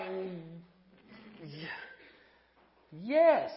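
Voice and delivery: drawn-out, reluctant acceptance; hesitant yes. Acted. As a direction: stall with a hum, then force out the yes. Acts wary answer to lunch invite.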